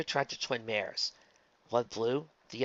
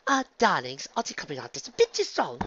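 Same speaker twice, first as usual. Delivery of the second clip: higher, louder, delicate objection; nervous socialite; 6 semitones higher, 4 dB louder than usual. Acted. Rarity addressing the attendants, uneasily polite. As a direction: object politely but anxiously.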